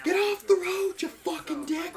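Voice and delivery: high, loud furious yell at a driver; road rage yelling. Retold or imitated. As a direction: scream it like someone cut you off. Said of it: "I start yelling".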